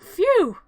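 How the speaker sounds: high, loud exhaled "phew"; exaggerated whoosh of relief; hammed-up relief, swooping high; mock relieved "phew". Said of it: "Sally's like".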